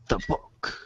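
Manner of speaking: ordinary talk, nothing marked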